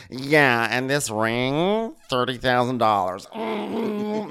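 slow, high, smug showing off; bragging. Retold or imitated. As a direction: flaunt it to your kid. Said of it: "Stop bragging".

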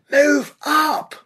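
high, barked command to advance; shouted order. A narrator's reading. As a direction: yell it to push the men forward.

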